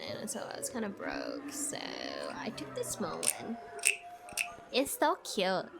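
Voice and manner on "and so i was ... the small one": whisper. Real, not acted. Confessing being broke.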